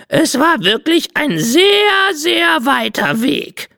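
high, slow, drawn-out parrot lament; milk every word like a martyred parrot; theatrical parrot. Acted. Parrot Cinzia dodging his question, slow high theatrical whine.

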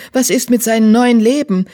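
urgent acted outcry; crying out. A narrator's reading. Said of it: "rief die Königin".

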